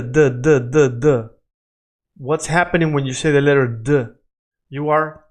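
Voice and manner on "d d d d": D said with tongue touching the teeth; Spanish D. Shown as a demonstration. Teacher showing how to form the Spanish D. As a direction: tap D, D against the teeth. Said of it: "you can say the letter D".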